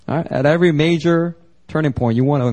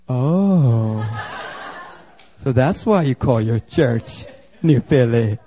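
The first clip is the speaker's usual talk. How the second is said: amused realisation; 3 semitones lower, much slower than usual. Retold or imitated. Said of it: "people realise he's from Philly".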